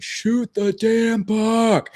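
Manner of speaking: high, slow drawn-out Texan holler; Texan accent. Retold or imitated. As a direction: stretch it out in a big Texan accent. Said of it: "in the most Texan accent I have ever heard".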